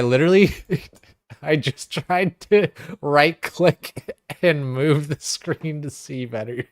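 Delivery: laughing. Real, not acted. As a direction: giggle through the words. Laughing at his own confusion.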